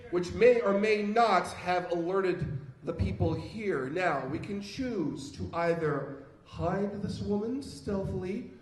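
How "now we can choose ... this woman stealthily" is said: ordinary delivery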